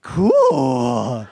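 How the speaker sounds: put-on long drawn-out, high kid's wow; awestruck child. Son seeing his bloody chin in a mirror, awestruck. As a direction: stretch it out in a child's loud awe.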